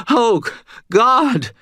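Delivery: high, loud frightened moan. A narrator's reading. Cashier moaning in fear as the freezer shatters. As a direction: groan it out in terror. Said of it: "Stan moaned".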